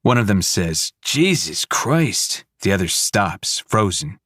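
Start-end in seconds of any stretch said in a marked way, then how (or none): 1.0-2.4 slow shocked. Acted. Officer horrified.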